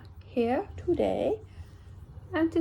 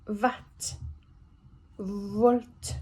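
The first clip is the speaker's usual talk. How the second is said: ordinary delivery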